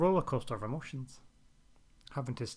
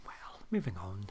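whisper; 4 semitones lower, 3 dB quieter than usual. Real, not acted. Awkward change of subject.

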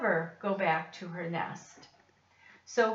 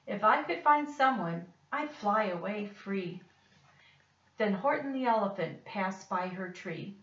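plain reading voice

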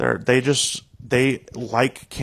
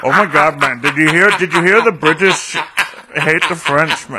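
loud, high, breathless gossip; mock excited gossip; 4 semitones higher, 8 dB louder than usual. Friends joking about the British, in loud mock-excited gossip. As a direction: gush like a scandalised friend.

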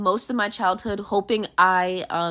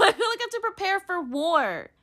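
laughing; 8 semitones higher, 5 dB quieter than usual. Real, not acted. Laughs at own remark.

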